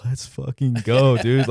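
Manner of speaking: high, loud, slow, drawn-out celebration; celebrating shout. Retold or imitated. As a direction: roar it out like you just got drafted. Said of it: "I'm like".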